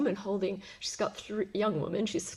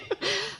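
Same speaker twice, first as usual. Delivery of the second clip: quick high real laugh; a short burst of laughter. Real, not acted. Still amused by the boy, short real laugh.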